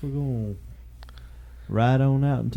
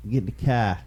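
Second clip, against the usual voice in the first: put-on Boston accent. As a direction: pretend Boston tough guy accent. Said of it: "Boston accent".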